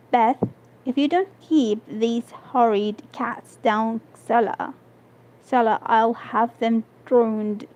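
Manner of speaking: ordinary delivery, nothing marked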